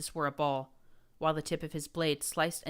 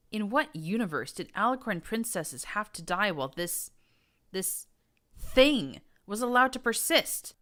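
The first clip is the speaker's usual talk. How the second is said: outraged; 6 semitones higher, 6 dB louder than usual. A narrator's reading. Narrator's outrage building.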